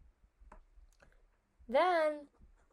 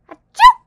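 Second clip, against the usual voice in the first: explosive shrieking sneeze, very high and loud; screeching mock sneeze; huge sneeze; 26 dB louder than usual. Shown as a demonstration. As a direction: blast out an over-the-top sneeze. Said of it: "No, it was more like".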